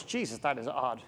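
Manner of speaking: low, quiet puzzled aside; barman muttering. Retold or imitated. Barman watching the odd customer, quiet puzzled aside. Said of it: "The barman goes".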